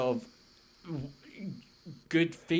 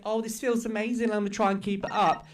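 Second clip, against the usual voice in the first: mocking high voice; 6 semitones higher, 4 dB louder, faster than usual. Mocking quote of the streamer.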